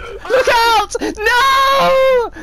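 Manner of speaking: high, loud wailing yell; frantic shout. Real, not acted. Player whose snowball goes astray, frantic shout. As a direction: yell a warning into a long cry. Shouted warning, then dismay.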